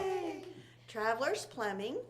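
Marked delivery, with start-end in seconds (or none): 0.0-0.4 high cheer; excited cheer. Real, not acted. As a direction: cheer them on. Cheering the sponsors.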